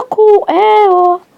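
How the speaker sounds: cris brefs, aigus et forts; réagir aux coups en direct; excité. Real, not acted. Combat dans le jeu. (cri aigu du joueur qui encaisse)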